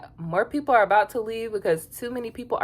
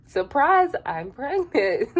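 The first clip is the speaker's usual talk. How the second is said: mock announcement; 8 semitones higher, slower than usual. Joking Huda's news to Ace, mock gleeful reveal. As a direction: sing out a fake reveal. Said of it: "gender reveal".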